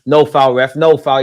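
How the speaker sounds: loud insistent protest; protesting to the ref. Real, not acted. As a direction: tell the ref it's no foul. Protesting to referee.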